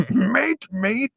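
high-pitched mock pirate voice; cartoon pirate. Podcaster mimicking co-host's matey, cartoon pirate voice. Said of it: "Pirate cartoon".

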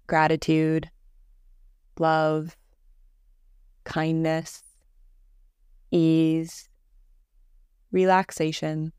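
calm, measured meditation voice with long pauses; guided meditation. Acted. Teacher leading a listener through Yoga Nidra breathing, guided meditation voice. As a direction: guide them gently into rest, evenly and unhurried.

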